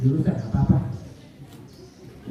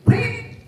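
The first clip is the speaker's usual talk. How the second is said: whistle imitation. Police officer blows his whistle.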